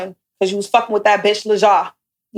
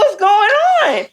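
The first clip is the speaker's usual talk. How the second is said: shocked outcry; 12 semitones higher, much slower than usual. Retold or imitated. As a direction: stretch it out in disbelief. Confronting friend who ditched her.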